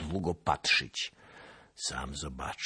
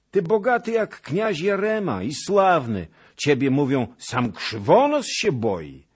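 much higher, loud coaxing hag; witch's flattery; 12 semitones higher, 12 dB louder than usual. A narrator's reading. Witch flattering the Cossack to win him over. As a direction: crow the praise like a witch.

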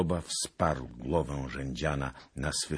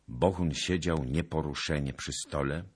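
plain reading voice

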